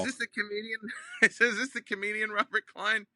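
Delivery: dawning surprise. Retold or imitated. Caller realizing he dialed the comedian, startled. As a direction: realize mid-call you've got the wrong man. Realizing wrong person on phone.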